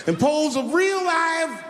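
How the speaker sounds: slow, high drawn-out punchline; slow reveal. Acted. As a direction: stretch each word out slowly and high.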